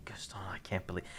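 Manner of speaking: hushed aside to the audience; whisper. Real, not acted. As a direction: whisper it under your breath.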